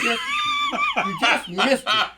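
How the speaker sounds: drawn-out high laughing taunt; drawn-out, high-pitched laughing tease; mock teasing voice. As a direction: stretch it way up and slow, teasing. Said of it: "You said".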